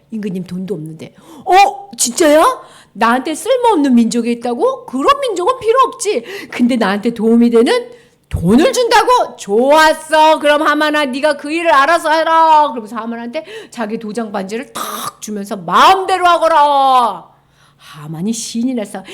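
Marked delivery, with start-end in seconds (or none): 1.4-12.7 louder, higher greedy king; a king delighted by easy money; eager king. Acted. King eagerly agreeing to Haman's plan, louder. 15.6-17.3 slow, louder kingly decree; royal command. Acted. King handing Haman his ring, slow and grand.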